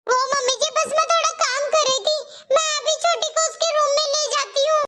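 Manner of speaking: meek bahu answering her mother-in-law; timid daughter-in-law. Acted.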